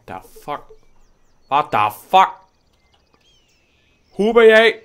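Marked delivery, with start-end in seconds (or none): 1.5-2.3 loud, startled repeat of what the fuck; loud incredulous cry; shocked outburst. Real, not acted. 4.1-4.8 very high, loud disbelieving cry; squealing astonishment; shocked cry. Real, not acted. Shocked at the animal.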